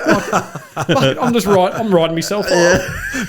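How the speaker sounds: high, loud, helpless laughter; cracking up while quoting yourself; laughing through retold rant. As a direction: drag the quoted words out through laughter. Retelling his taped self-criticism, cracking up.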